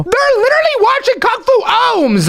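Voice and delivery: high, incredulous outburst; indignant disbelief. Acted. Host catches the pranksters relaxing at TV, indignant. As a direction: report it in outraged disbelief.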